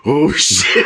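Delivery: mock shout through laughter; the lion spotting the crocodile, laughing yelp; laughing lion panic. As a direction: yelp it while cracking up. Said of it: "you see the lion going".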